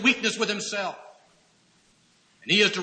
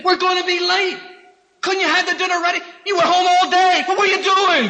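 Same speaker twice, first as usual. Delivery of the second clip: high, loud impatient scolding; husband scolding the unready family; irritated husband; 14 semitones higher, 9 dB louder than usual. Retold or imitated. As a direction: snap at the wife for being late. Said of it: "Does that kinda sound like irritation?".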